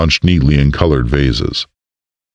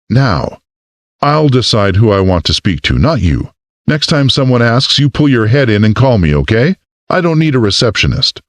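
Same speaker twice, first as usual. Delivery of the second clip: higher, firm scolding mayor; lightly voiced stern boss; stern woman; 7 semitones higher than usual. A narrator's reading. Marley scolds Erica.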